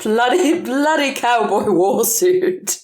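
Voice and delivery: ordinary talk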